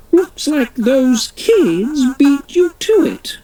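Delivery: high, teasing royal voice; the Queen. Acted. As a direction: tease the reporter she's been beaten.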